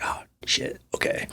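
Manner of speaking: hushed, breathless whispered curse; startled hush. Retold or imitated. Retelling a near miss on the road, a hushed whispered curse. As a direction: mutter the curse under your breath in shock.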